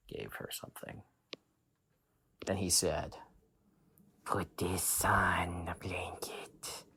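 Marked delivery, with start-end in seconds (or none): 4.2-6.9 slow secretive whisper; witch doctor whisper. Acted. Witch doctor tells the mom his secret, slow whisper.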